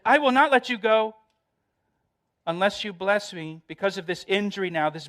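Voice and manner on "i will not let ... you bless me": pleading cry. Acted. Jacob clinging, pleading with God.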